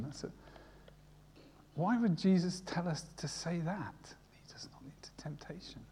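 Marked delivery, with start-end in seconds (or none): 1.7-5.8 unvoiced whisper, leaning in to the congregation. Real, not acted. Preacher musing to his congregation, whispering. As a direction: breathe the words like a secret.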